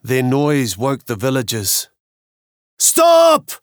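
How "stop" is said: high, loud cry of alarm; shouting. Acted. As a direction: yell it out to stop them. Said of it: "cried the villagers".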